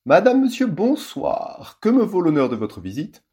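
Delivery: high, loud mock-posh welcome; exclaiming hostess. A narrator's reading. Hostess opening the door to visitors, high theatrical welcome. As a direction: greet the visitors with exaggerated grandeur.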